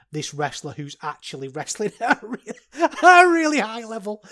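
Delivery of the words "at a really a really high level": high, loud words through real laughter; laughing. Real, not acted. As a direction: crack up mid-sentence at your own joke. Laughs at own self-mockery.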